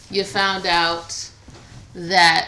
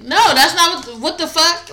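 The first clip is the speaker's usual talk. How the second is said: mock outrage; 7 semitones higher, 7 dB louder than usual. Imagined partner's outraged reaction.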